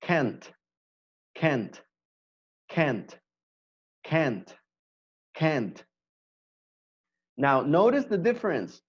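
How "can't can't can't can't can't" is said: "can't" repeated with a crisp final T, each falling; repetition drill. Shown as a demonstration. Teacher drilling the negative for students, crisp T. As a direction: drill "can't", hitting the T every time. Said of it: "the negative form".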